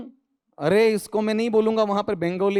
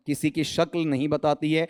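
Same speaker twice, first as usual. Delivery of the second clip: normal talking voice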